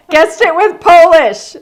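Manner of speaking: laughing high and loud through the words. Real, not acted. Host laughing as she names the winner, giggly. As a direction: burst out laughing while announcing.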